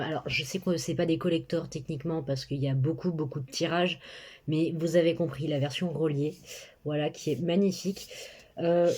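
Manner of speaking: usual speaking voice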